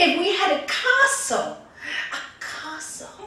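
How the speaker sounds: loud, high, swooning excitement; hammed-up ecstatic greedy wife; rapturous wife. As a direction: get carried away, crying out the dream. Wife carried away dreaming of castle.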